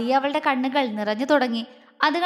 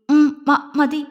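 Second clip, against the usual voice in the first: shy halting stammer, breaking off; mild nervous stammer; 5 dB louder, much slower than usual. A narrator's reading. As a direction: trip over the words, shy and unsure. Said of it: "അവള് വിക്കിക്കൊണ്ട് പറഞ്ഞു".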